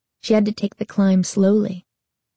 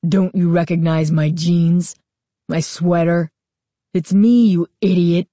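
gruff voice. A narrator's reading. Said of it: "declared in the gruff voice".

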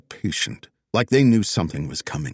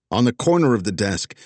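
ordinary delivery

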